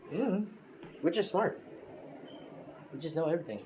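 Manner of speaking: put-on high, smug little-kid voice; cheeky kid voice. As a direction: brag like a know-it-all preschooler. Kids boasting to Deku.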